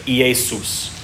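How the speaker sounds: "Iesus" said ee-AY-soos; Greek form. Shown as a demonstration. Pastor answering a question, sounding out ee-AY-soos. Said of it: "closest thing we can get to it is the iota".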